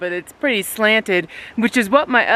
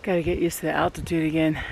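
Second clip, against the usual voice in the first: panting, out of breath from the climb; 3 semitones lower, 5 dB quieter, faster than usual. Real, not acted. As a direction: talk while catching your breath. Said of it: "I gotta catch my breath".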